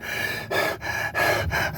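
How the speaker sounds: heavy breathless exhale at the door; mock out-of-breath huff; winded sigh. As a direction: puff out air like you just climbed stairs. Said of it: "rolling up to the door going".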